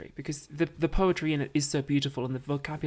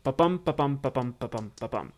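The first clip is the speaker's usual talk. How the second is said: "ba-bum" with weak first beat, strong second; iambic rhythm; 4 semitones lower than usual. Shown as a demonstration. Actor demonstrating iambic pentameter, "ba-bum" stressed on "bum". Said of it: "ten syllables per line with alternating emphasis".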